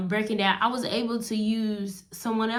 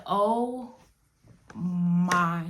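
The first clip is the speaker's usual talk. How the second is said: tearful; 3 semitones lower than usual. Retold or imitated. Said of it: "I busted out in tears".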